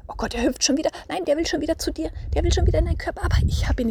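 high, excited mock panic; play-acted alarm. Shown as a demonstration. Mother stopping the escaping anger dwarf, mock panic. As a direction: build to a high, loud theatrical fluster. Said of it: "ich stell's jetzt wirklich mal so dar".

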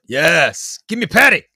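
loud, eager demand; playful shout of fan excitement; excited cheer. Pretend. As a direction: cheer it loudly, rising high at the end. Excited cheer for the Bigfoot episode.

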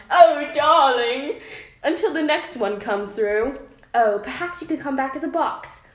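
overacted royal British banter; British accent. Shown as a demonstration. Reading the lovers' transcript to a friend, hammed-up posh British. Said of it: "should I read it in an accent".

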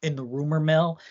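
normal talking voice

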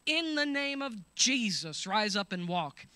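booming apostolic command; commanding proclamation. Acted. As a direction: proclaim it with full authority. Peter's command voiced in sermon.

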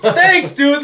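high, cheeky smart-ass thanks; sarcastic thanks. Retold or imitated. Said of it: "And I was like".

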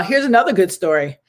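normal talking voice

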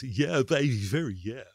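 lightly put-on smoothie voice; mock seducer, loud then easing off; joke "real voice". Host showing off his supposed real voice for laughs, playful smoothie act. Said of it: "or my real voice is".

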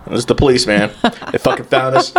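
normal talking voice

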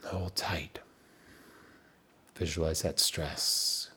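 calm counting coach, nearly whispering by the end; guided breathing. Acted. Leads a timed breathing exercise.

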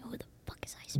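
breathy whispered question, quick; quick whispered mock of Trump. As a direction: hiss it out like a baffled aside. Quoting Trump's confused reply.

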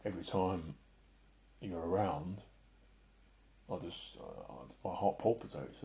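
quiet, halting, choked-up delivery; soft and low, struggling to get the words out; getting emotional. Real, not acted. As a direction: falter softly with long pauses, overcome by feeling. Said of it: "get emotional now".

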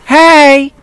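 put-on loud, high drunken "hey" holler; drunk woman. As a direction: holler it high like a tipsy party girl. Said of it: "one chick who's drunk".

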